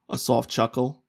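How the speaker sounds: ordinary talk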